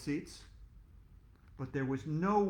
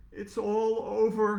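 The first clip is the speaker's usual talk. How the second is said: despairing groan; 6 semitones higher, 6 dB louder, slower than usual. Acted. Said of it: "groaned Mister Cooper".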